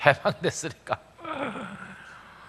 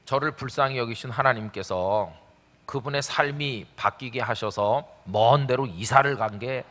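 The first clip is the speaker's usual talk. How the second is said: ordinary delivery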